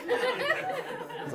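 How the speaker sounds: laughing high and stretched out. Real, not acted. Comedian retelling dad's scolding, laughing. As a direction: break into laughter mid-line. Dad scolding then stunned reaction.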